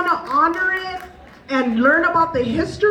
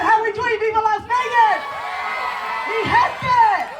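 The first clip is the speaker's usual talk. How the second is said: crowd-rousing shout; 9 semitones higher than usual. Real, not acted. Emcee hyping a Las Vegas crowd, shouting high. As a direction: fire up the whole room.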